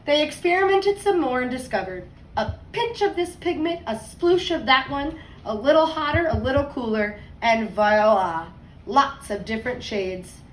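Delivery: put-on lively read-aloud voice for kids; story narration. A narrator's reading. Teacher reading a picture book to her class, storytime voice.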